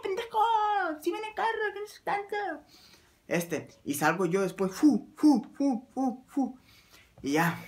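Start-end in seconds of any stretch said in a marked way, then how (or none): 0.0-2.4 very high, strained mock yell; woman's distant scream. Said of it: "Y yo riéndome, y". 4.7-6.6 high, puffed "fu" whooshes; whooshing run noises. Retold or imitated. Imitates dashing across the road.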